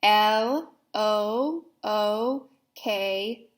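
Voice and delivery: letters spelled out slowly and evenly; slow spelling. Shown as a demonstration. Teacher leading children in slow spelling.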